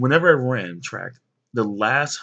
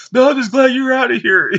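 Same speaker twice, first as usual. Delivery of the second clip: high, loud, sour dismissal; mad retort; 12 semitones higher, 8 dB louder than usual. Retold or imitated. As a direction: spit it at him as you leave. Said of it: "He walks away mad".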